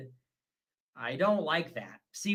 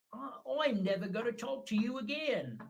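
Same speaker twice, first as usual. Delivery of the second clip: badly faked British accent, higher voice; failed British accent; 4 semitones higher, 5 dB quieter than usual. Redoing the British streamer's threat, botched British accent. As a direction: attempt a British woman and miss. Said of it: "That's not British".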